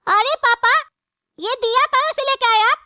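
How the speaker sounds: put-on high, childlike voice asking papa eagerly; child voice. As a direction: play the little kid, high and wide-eyed. Child voice in sketch. (ऊँची बच्चे जैसी आवाज़)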